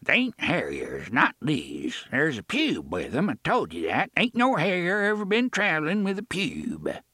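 put-on old man in a thick rural accent. A narrator's reading. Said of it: "he spoke with a thick accent".

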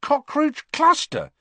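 high, slow, wondering question; can't believe "Cockroach cluster" worked; amazed. A narrator's reading. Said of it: "he said, amazed".

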